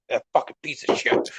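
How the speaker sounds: hushed, voiceless angry cursing; venting, not yelling. Retold or imitated. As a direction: grumble it under the breath, no shouting. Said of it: "like, not yelling at her".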